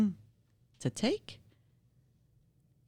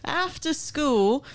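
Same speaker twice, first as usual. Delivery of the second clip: high, loud eager reading; excited; 10 semitones higher, 6 dB louder than usual. Acted. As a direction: read it with a rush of energy.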